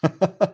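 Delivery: a short bright laugh shown on cue; demo laugh. Shown as a demonstration. Showing listeners how to laugh it off: bright laugh. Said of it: "is laugh".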